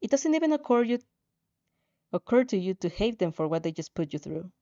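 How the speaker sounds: ordinary delivery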